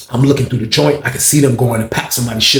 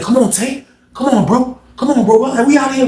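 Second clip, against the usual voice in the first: high, insistent urging; retold urgent calling; 11 semitones higher than usual. Storyteller pulling Tay away from the fight, retold urgent calling. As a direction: call the friend away in a high, pressing voice. Retelling urging friend to leave.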